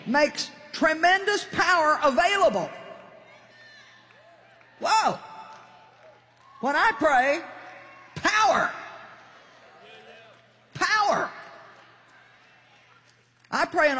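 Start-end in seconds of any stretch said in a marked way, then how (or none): 0.0-11.3 high, punched-out preacher exclamations; emphatic preaching. Real, not acted. Preacher exclaiming to the congregation about prayer, emphatic preaching. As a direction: proclaim it in high, ringing bursts with long pauses.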